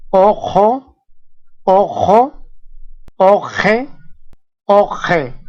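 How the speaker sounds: "ojo, oje" with the U opened to O; teacher modelling "ojo, oje", each word set apart; U as O beside Q. Shown as a demonstration. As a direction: say "ojo, oje" with round O and a rasped back Q. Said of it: "suena como si fuera O".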